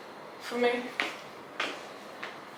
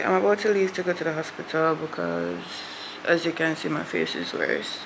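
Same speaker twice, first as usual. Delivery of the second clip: low and subdued; 5 semitones lower, 8 dB quieter than usual. Real, not acted. Unwell, not in mood.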